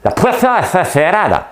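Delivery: high, loud Spanish with exaggerated lisping; lisped Spanish accent. Mimicking how the series actors talk, lisped high Spanish shout. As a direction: shout in Spanish, turning the s sounds into lisps. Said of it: "Ben, ils mettent des".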